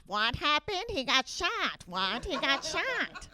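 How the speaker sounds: lightly acted back-and-forth between two moviegoers; puzzled questions, drawn-out last answer. Two moviegoers talking through the film, lightly voiced chatter.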